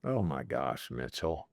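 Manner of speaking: fighting back tears; choked up. Real, not acted. CEO moved by a question about his daughters, choked up. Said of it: "You wanna make me cry".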